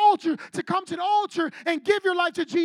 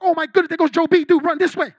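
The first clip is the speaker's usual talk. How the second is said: alarmed mock panic; 10 dB louder, faster than usual.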